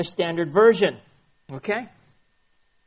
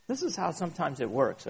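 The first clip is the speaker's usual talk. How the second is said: ordinary talk, nothing marked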